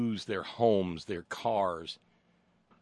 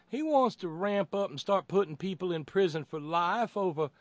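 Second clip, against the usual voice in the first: very high, hammed-up Southern apologist; mock Southern accent; 12 semitones higher, 4 dB louder than usual. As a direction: parody of a fawning Southern pundit. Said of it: "doing this in a Southern accent".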